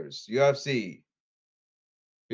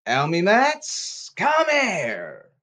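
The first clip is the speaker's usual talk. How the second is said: high, drawn-out shout; loud, high shout stretched out long; emotional call-out; 6 semitones higher, 7 dB louder, much slower than usual. Real, not acted. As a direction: yell slowly, summoning the culprits. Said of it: "I'm gonna get very emotional here".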